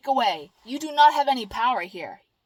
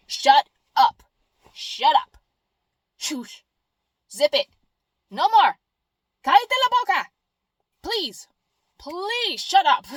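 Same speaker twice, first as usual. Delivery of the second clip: louder, higher fed-up shushing; mock shut up; 4 semitones higher, 6 dB louder than usual. Telling the attention-seeking noise to shut up, loud. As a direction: silence the nagging noise.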